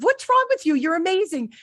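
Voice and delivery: high, loud mock disbelief; incredulous. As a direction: exclaim in retold astonishment at a friend's self-doubt. Retells incredulous reply to doctor friend.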